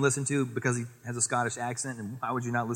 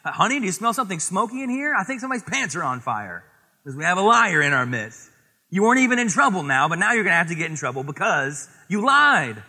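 higher, louder mock scolding parent; teasing parent; 3 semitones higher, 6 dB louder, faster than usual. Parent teasing the lying child, high, mock scolding. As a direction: tease the little liar, then scold. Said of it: "they're like".

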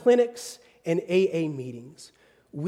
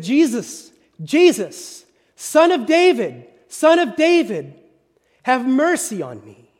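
pleading shout, louder and higher; 5 semitones higher, 7 dB louder than usual. Retold or imitated. Blind beggar crying out to Jesus, loud pleading shout. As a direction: cry out for mercy over the crowd. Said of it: "He started shouting at them".

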